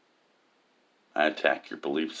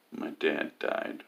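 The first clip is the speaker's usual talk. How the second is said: slow, whispered reading of a post; 4 semitones lower, 8 dB quieter, much slower than usual. Retold or imitated. Reading a friend's Facebook post aloud, slow and whispered. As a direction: mouth the typed words slowly, half-whispered.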